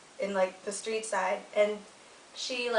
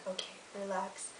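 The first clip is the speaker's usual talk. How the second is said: much softer, low self-soothing; calming himself; 3 semitones lower, 15 dB quieter than usual. Retold or imitated. Dad alone in the stopped car, soft self-calming words. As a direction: talk yourself down after a deep breath.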